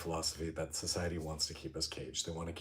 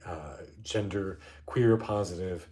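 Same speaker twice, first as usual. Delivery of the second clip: ordinary delivery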